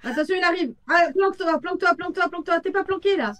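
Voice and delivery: high, loud frantic warnings; panicked shouting. Real, not acted. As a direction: yell at your friend to hide. Ghost hunting teammate, panic.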